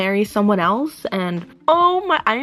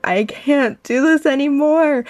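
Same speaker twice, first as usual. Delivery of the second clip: higher and louder, at the end of her rope; exasperated outburst; 5 semitones higher, 4 dB louder than usual. Real, not acted. A book reader, fed up with the characters, higher and louder in real exasperation. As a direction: throw the same words out in real exasperation. Overwhelmed reader repeats herself.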